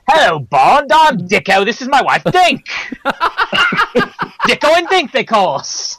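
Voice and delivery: high-pitched, hammed-up Australian voice; put-on Australian, laughing at the end; Australian accent. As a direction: introduce yourself as a squeaky comic Australian. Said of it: "have him be Australian".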